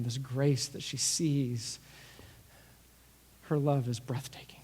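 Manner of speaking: softening, ending in a whisper on "her love is breathtaking"; tender, hushed. A narrator's reading. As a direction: gently draw him in, fading to a whisper. Jesus tenderly explains grace.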